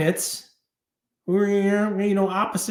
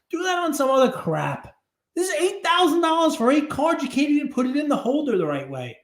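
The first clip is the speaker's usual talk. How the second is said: normal talking voice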